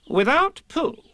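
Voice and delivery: high, loud, grave Rabbit; announcing with gravity; solemnly. A narrator's reading. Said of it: "said Rabbit solemnly".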